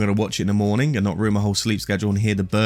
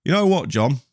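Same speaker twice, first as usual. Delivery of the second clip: put-on English accent; 5 semitones higher than usual. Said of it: "I can't even do the accent".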